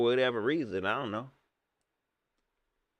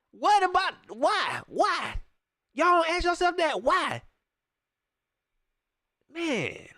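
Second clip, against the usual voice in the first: high, frustrated repeated whys; exasperated high-pitched ranting. Real, not acted. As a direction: plead with them to ask why. Frustrated rant at front office.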